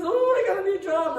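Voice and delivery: high, loud acted delight; excited announcement. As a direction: burst out with your big news. Imitates someone announcing good news.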